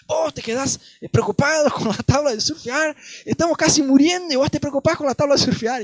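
frightened, angry rebuke; angry brother. Retold or imitated. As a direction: snap at him for worrying about a surfboard. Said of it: "mi hermano se recontra enojó".

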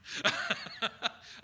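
loud, high burst of laughter; unvoiced cackle of amusement at the lost reference; laughing. Real, not acted. Teacher whose old TV reference falls flat, laughing.

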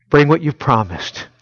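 imagined disciples' prayer. Retold or imitated. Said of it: "I suspect they were praying".

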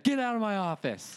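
high, curt dismissal; angry doctor. Retold or imitated. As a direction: order him out angrily. Doctor throws him out.

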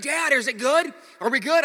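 put-on high, loud anxious child's questions; imitated panicking daughter. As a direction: plead to dad in a mock panic. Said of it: "because Ava's out of control".

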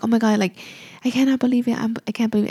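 ordinary delivery

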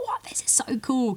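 hushed, awed what, this is so cool; amazed whisper. Retold or imitated. Host hearing a raw demo, whispering in awe. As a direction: whisper it like you've struck gold. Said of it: "I've gone".

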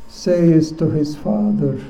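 ordinary talk, nothing marked

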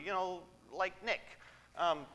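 ordinary talk, nothing marked

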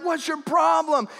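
high, drawn-out retold shout; exasperated road rage. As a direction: yell it at the other driver. Retelling honking at a driver.

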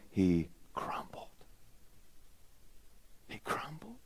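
breathy whispered collapse. Real, not acted. Preacher whispering Peter's collapse to the congregation. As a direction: drop to a near whisper.

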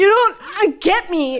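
put-on high sulky teenager; sulky teen. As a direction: whine it like a moody kid. Teen complaining to mom.

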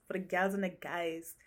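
soft attempt at a Jamaican accent; badly faked Jamaican lilt; rough Jamaican accent. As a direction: play at sounding Jamaican, softly. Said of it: "Jamaican accent, kind of".

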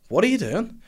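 high-pitched, insistent mimicked questioning; imitated demanding question. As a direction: press the question, voice leaping up. Asks again, caught him gesturing.

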